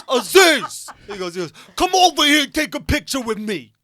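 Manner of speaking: a horrible loud Tracy Morgan impression. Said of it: "This is a horrible Tracy Morgan impression".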